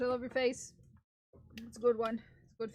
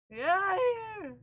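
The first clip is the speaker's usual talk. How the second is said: cracking voice; 7 semitones higher, 4 dB louder than usual. Real, not acted. Streamer answering chat, voice cracking near tears. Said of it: "that cracking voice".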